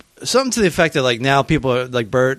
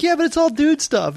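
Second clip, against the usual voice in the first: mock excuse; 11 semitones higher than usual. Voicing the woman's excuse.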